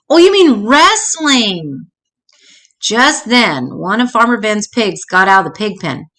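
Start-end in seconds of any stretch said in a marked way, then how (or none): 0.1-1.9 slow, higher correction; dawning understanding. A narrator's reading. Sister works out Milton's word, slow realisation. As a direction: draw it out as it clicks.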